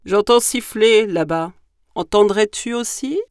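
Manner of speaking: loud, booming monster question; big creature voice on the alert; gruffalo voice. Acted. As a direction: ask like a big beast. Gruffalo asks the mouse.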